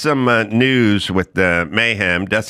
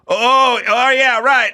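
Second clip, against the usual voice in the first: sheepish realization; 13 semitones higher, 4 dB louder, slower than usual. Retold or imitated. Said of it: "And I'd go".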